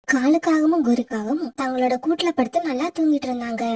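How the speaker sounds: low, even storyteller's voice; story narration. A narrator's reading. Narrator telling listeners how the crow wept, low storyteller read. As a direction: narrate the sad fable steadily for listeners.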